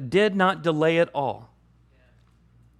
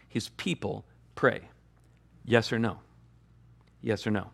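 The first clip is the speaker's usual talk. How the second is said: quiet; 5 semitones lower, 6 dB quieter than usual. Real, not acted. Quiet sermon emphasis.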